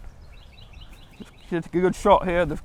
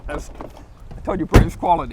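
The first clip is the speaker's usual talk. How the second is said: fake British accent; 3 dB louder than usual. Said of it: "your best British accent".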